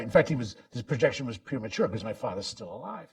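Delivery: ordinary delivery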